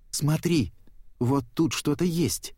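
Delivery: high-pitched cry of discovery; excited call. A narrator's reading. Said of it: "крикнула Дори".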